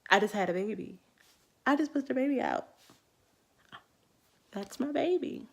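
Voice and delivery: bubbling over with amazed joy; giddy disbelief. Retold or imitated. New mother can't believe she gave birth, giddy joy.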